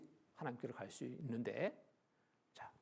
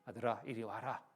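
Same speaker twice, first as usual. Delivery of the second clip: gentle father; 4 semitones lower than usual. Retold or imitated. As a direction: coax him out gently. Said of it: "부드러운 음성이".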